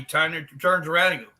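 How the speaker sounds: ordinary delivery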